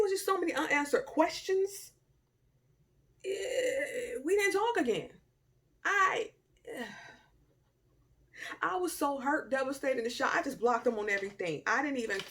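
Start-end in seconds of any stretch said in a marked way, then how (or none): 3.2-6.8 high, tearful break; real tearful catch. Real, not acted. A high, tearful voice as she recalls the breakup. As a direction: let the voice catch and crack. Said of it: "crying heard".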